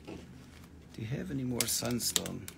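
ordinary delivery

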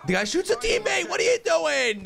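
high, slow, stretched disbelieving shout; outraged yell. Real, not acted. Gamer yelling at teammate who shot a friend, high outraged shout.

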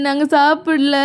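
drawn-out put-on child's wail; mock crying. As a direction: wail the denial like a scolded kid. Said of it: "அழற sound கேட்கல". (பொய்யாக இழுத்து அழும் குரல்)

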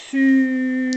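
"Su" with a long loud held s; stretched syllable. Shown as a demonstration. Teacher stressing the s in "Su", loud and long. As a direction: lean on the s, keep it going. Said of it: "on insiste".

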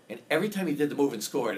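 ordinary talk

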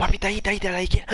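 excited callout. Real, not acted. Spotting enemy, calling out.

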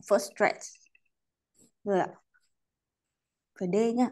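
ordinary talk

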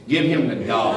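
put-on low, resigned little-boy verdict; deadpan concession. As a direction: drop low to concede defeat dryly. Boy concedes the biggest lie.

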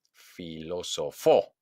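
slow "filosofó", punched on FÓ; stress on final syllable. Shown as a demonstration. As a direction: land on FÓ in "filosofó". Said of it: "hago un golpe de voz". ("filosofó" lento, con golpe en FÓ)

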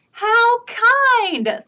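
a delighted cat purring thanks. Acted. Cat speaks in story.

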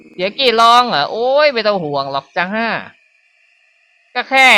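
mock-cheerful sinister housewife; creepy fake-sweet mother. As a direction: chirp it off like nothing's wrong.

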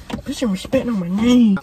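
hammed-up catchphrase; put-on catchphrase voice. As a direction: do it as a caricature. Repeat in put-on voice.

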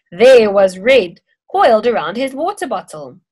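ordinary delivery

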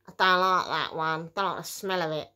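mild mum voice, fussy; a hint of mum complaining; quoting mum. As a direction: lightly play the picky mother. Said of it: "my mum says".

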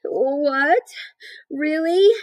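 high-pitched, astonished squeal; cried out. A narrator's reading. Wilbur hears Charlotte say he shall not die, crying out. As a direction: cry it with sudden hope. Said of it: "cried Wilbur".

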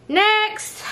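high, loud shout of "next"; loud, high-pitched holler; calling out. Real, not acted. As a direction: call it out like summoning the next one. Calls out for next outfit.

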